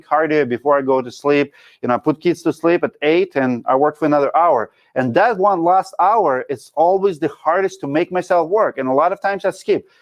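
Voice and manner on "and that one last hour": ordinary talk, nothing marked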